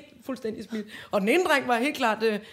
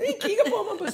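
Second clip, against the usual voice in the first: put-on very high, whimpering child voice; teacher voicing a sobbing girl blaming the boys; little girl; 10 semitones higher, faster than usual. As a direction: complain like a tearful small girl. Girl quoted again.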